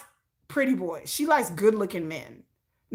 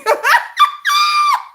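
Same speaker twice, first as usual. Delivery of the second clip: very high, loud laughing scream; 9 dB louder than usual. Real, not acted. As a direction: shriek with laughter. Laughing shriek.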